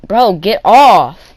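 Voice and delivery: firm loud warning. Retold or imitated. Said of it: "I tell him".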